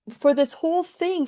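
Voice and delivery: ordinary delivery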